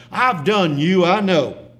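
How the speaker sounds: high, loud exclamation of recognition; crying out. A narrator's reading. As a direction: cry it out with force. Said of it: "cried the artist".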